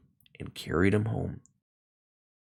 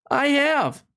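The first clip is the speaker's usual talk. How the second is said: cried; 3 dB louder than usual. A narrator's reading. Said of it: "cried the little rabbit".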